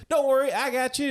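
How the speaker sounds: high-pitched mimicked voice; Ernest. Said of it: "he mimics Ernest's voice".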